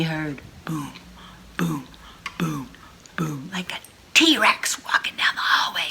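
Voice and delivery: hushed suspense. Acted. Storyteller building suspense to the kids in a whisper. Scary approaching footsteps.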